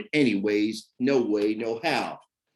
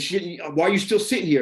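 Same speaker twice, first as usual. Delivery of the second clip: higher, louder angry scolding; aggressive; 7 semitones higher, 3 dB louder, faster than usual. Retold or imitated. Father scolding stepson for not getting ready, aggressive. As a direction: demand an answer, pushing hard. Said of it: "I was very, like, aggressive".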